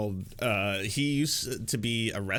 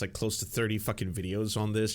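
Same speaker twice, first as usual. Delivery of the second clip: normal talking voice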